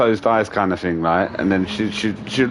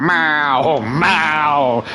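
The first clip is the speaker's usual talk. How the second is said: higher, drawn-out cat yowl; fearful meow; 4 semitones higher than usual. Retold or imitated. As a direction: imitate a frightened cat wailing meow. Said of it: "his eyes are open with fear".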